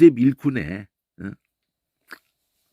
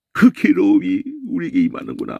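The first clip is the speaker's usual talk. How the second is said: lightly voiced brothers' regret; acted lament; 5 semitones higher than usual. Brothers realizing their punishment, lightly acted lament. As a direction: sigh out the guilt a little higher. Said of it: "그 장면을 연출시켜주니까".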